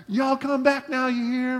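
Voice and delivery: high-pitched put-on Deep South accent; Jesus with southern accent. A country-talking figure welcoming folks in heaven, comic Deep South accent. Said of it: "Jesus talks like he's from the deep south".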